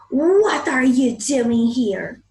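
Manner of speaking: slow, loud angry giant roar; angry shout. Acted. Giant finding children in his garden, angry shout. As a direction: shout at the kids to get out. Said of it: "he shouts in a very angry voice".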